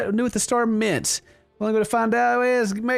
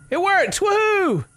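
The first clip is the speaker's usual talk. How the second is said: much higher, excited cheer; 12 semitones higher, slower than usual. Real, not acted. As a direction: celebrate the door finally opening. Excited at the door opening.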